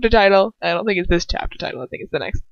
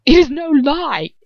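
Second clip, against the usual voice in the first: higher, slow furious retort; furious mutter; 7 semitones higher, 4 dB louder, slower than usual. A narrator's reading. Lucius denying it to her with slow fury. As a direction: bite out each word. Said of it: "he mutters furiously".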